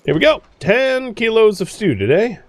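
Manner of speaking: high, delighted cheer; chirpy, pleased with yourself; gleeful. Real, not acted. Cheerful at finding stew.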